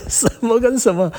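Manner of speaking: incredulous. Real, not acted. Incredulous comment after laughing.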